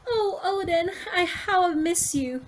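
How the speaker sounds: high, slow, tearful wife's voice; tearful Toki. Acted. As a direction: greet your husband with a small sob in the voice. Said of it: "Toki welcoming Oden".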